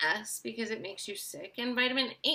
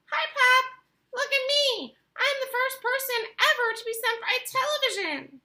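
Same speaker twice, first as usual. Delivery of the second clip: very high, mouse-sized squeak; tiny squeaky voice; 8 dB louder than usual. A narrator's reading. Tiny shrunken boy greets his mother in a squeaky voice. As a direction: squeak like a boy an inch tall. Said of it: "A tiny little voice".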